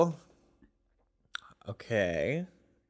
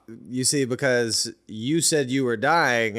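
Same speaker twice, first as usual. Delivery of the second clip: ordinary delivery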